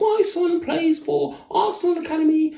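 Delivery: high-pitched boastful mum parody; very high, put-on proud mother; mock social-media mum. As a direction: brag in a silly high mum voice. Mimicking social media mums.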